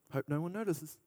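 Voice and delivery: quiet, hoping to hide it; sheepish murmur. Pretend. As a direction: say it under your breath. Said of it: "He has two things he can do".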